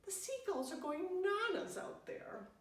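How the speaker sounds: high, loud, excited chirp; perky friend bursting with spring excitement. Acted. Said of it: "She's all perky".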